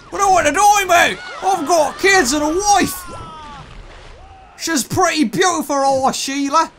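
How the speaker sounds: high, put-on Australian voice; weird Australian accent. Prey begging for his life with kids and wife, weird Australian accent. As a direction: plead in a hammed-up Aussie accent. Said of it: "That was a weird Australian accent".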